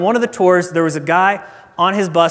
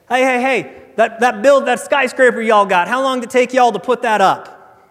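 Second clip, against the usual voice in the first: higher-pitched, pushy tourist question; Texan tourist piping up; 5 semitones higher than usual. Acted. Texan tourist interrupting the tour guide, piping up. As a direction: pipe up as a big-personality Texan. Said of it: "the Texan pipes up at some point".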